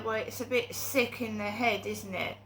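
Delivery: ordinary talk, nothing marked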